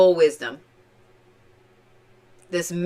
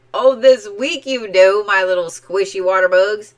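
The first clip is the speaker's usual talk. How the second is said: strong Oklahoman twang, louder; Oklahoma accent; 5 dB louder than usual. Real, not acted. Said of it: "I really got a bad accent there".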